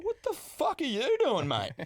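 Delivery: high, slow, indignant what the fuck; calling out a queue jumper; retold outraged challenge; confronting. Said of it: "I've just leaned and I said".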